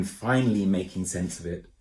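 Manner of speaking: plain reading voice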